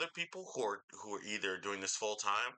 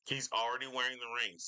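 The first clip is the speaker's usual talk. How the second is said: usual speaking voice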